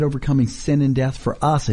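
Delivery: normal talking voice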